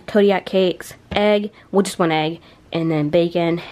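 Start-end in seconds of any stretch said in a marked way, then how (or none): none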